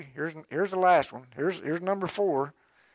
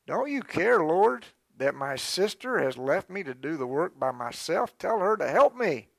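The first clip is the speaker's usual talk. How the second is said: complaining Martha; 3 semitones higher than usual. Acted. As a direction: whine it like an overworked sister. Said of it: "And we begin to complain".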